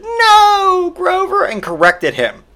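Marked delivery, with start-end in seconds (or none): 0.0-1.4 put-on very high, stretched child's voice; little kid voice. Little kid correcting Grover, high mock child voice. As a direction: correct him in a squeaky kid voice. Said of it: "the little kid that he said it to said".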